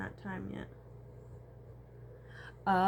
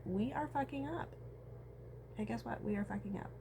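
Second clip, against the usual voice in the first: normal talking voice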